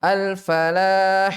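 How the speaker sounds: Al-falah stretched very slowly with a heavy ha; slow drawn-out recitation. Shown as a demonstration. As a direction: draw out Al-falah syllable by syllable. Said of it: "Coba sama-sama".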